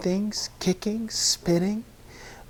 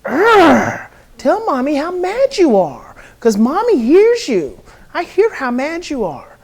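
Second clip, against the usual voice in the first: high, loud mock-angry growl and echo; mirroring a child's anger; 8 semitones higher, 7 dB louder than usual. Shown as a demonstration. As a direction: voice the child's anger back at him, big. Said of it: "you just start saying".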